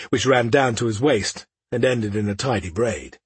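plain reading voice

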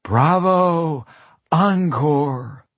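high, loud cheer; calling bravo. Acted. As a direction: call out like a fan at a show. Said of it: "clapped her hands and called".

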